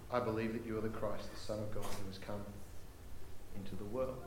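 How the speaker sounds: very soft, low confession; quiet faith. Acted. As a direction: confess it reverently, hushed and low.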